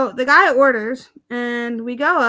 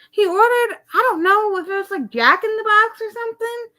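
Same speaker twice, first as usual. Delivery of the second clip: excited; 9 semitones higher than usual. Real, not acted. Excited retelling, voice jumps up.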